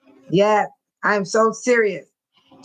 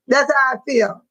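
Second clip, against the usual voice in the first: normal talking voice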